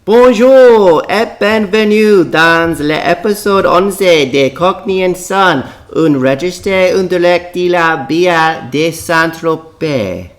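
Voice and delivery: slow, careful, high French announcer voice; French greeting. Shown as a demonstration. Father opens the podcast in French, slow and high. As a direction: say it slowly in your best French.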